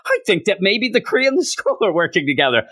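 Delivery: a hint of a grave heroine; mock Carol. Carol voiced higher.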